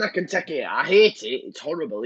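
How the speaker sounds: normal talking voice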